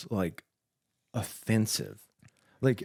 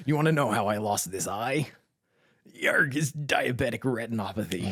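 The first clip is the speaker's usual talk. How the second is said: pirate voice; 3 semitones higher than usual. Pretend. Pirate costume joke.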